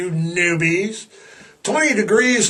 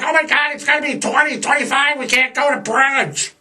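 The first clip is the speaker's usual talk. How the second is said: whiny complainer; 6 semitones higher, faster than usual. Retold or imitated. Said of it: "everybody's like".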